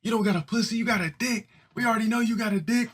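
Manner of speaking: high, fast mock kid taunt.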